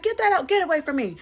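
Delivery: high, loud order to back off; alarmed grandma. Retold or imitated. Grandma ordering the kids away from the board, high and loud. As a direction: shoo them away loudly.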